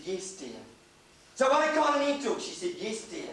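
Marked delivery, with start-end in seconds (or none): 0.1-0.6 meek yes dear. Retold or imitated. Meek wife answers husband. 1.3-2.4 demanding husband. Retold or imitated. Husband demands, higher and fast. 2.8-3.3 meek yes dear. Retold or imitated. Meek wife answers.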